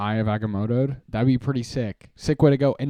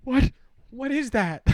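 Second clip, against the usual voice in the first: high startled cry; 13 semitones higher than usual. Retold or imitated. Himself startled by his new voice, high and alarmed. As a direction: squeak it out, suddenly alarmed. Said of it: "I'm like".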